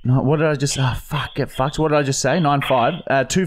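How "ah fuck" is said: usual speaking voice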